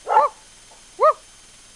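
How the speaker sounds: high, playful fake woof to the dog; high imitated bark. Owner barks high at her dog to rouse it, playful.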